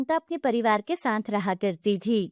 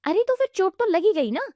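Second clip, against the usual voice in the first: high, louder snapping aunt; angry retort; 8 semitones higher, 4 dB louder than usual. Acted. Aunt snapping at the widow, high and loud. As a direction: snap it back.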